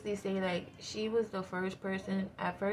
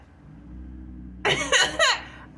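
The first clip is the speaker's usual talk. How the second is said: high airy laugh; high breathy laugh; 7 dB louder than usual. Real, not acted. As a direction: wheeze with laughter. Laughs at her own reaction.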